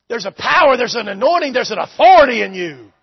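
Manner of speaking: loud, hammered declarations; emphatic preaching. Acted. Preacher telling the church of their authority, loud emphatic preaching. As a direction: build to a loud hit on authority.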